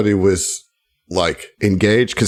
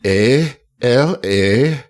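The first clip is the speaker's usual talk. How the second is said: put-on slow, drawn-out letter-by-letter spelling in a bright Minnesota accent; imitating a Minnesota contestant spelling Alabama, bright Minnesota accent; much slower than usual. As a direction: spell the state out slowly like a cheery Minnesota pageant girl. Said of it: "all bright in that Minnesota accent".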